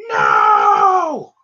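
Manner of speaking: a loud, pained cry of no; desperate shout. Acted. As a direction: yell it out in despair. Hero protests the enemy's escape.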